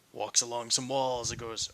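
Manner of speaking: normal talking voice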